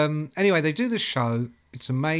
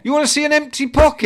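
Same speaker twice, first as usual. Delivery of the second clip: loud, fast, higher Midlands challenge; Midlands accent; 13 semitones higher, 10 dB louder, much faster than usual. Retold or imitated. Glenn daring the journalist to check his pockets, Midlands accent. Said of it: "Midlands accent".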